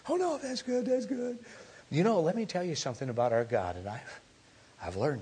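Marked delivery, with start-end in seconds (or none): 0.1-1.4 put-on high, softer little kid; nervous child reassuring dad, high and softer; child's voice.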